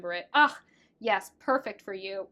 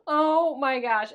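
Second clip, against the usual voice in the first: overwhelmed; 4 semitones higher, 10 dB louder, slower than usual. Real, not acted. Overwhelmed by the card.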